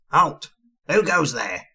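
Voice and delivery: sentry's cry. A narrator's reading. Goblin sentry challenging arrivals at the gate, crying out. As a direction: call it out like a sentry. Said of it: "cried the goblin sentry".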